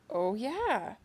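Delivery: loud, high mock revelation; exaggerated dawning surprise; surprised realization. Acts out suddenly remembering the band.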